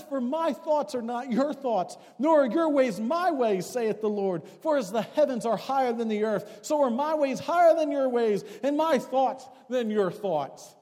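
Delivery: loud, high, measured scripture reading; preaching scripture. Acted. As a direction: declaim the verses to the congregation with full force. Reads scripture at full preaching force.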